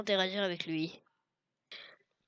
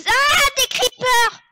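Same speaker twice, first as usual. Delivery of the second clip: panicked shriek; 13 dB louder than usual. Acted. Creepers appear.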